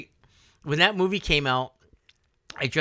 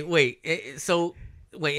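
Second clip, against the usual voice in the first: normal talking voice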